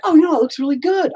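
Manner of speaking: high, quick put-on enthusiasm; mock self-approval. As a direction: mimic your earlier gushing in a squeaky voice. Mimics own earlier false approval.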